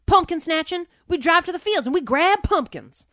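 loud, high Southern drawl; hammed-up country boy explaining. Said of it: "He goes".

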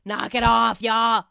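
rough Boston mom telling them off, lightly done; Boston accent. Retold or imitated. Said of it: "a Boston accent".

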